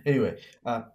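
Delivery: ordinary talk, nothing marked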